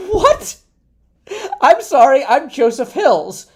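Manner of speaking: high, loud, laughing what; cracking up in surprise; laughing disbelief. Retold or imitated. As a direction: blurt it out between laughs at the coincidence. Stunned by the matching name.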